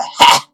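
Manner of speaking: short shouted "ha!" like a preacher on the mic; mock pastor shout. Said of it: "That yelling into the mic".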